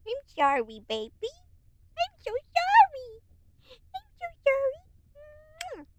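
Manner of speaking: put-on high, slow, whimpering baby-talk apology; baby talk to cat. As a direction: coo a mock-crying sorry to the cat.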